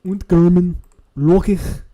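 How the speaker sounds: exaggerated mock German accent. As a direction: bark it like a parody German. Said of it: "You were talking like that".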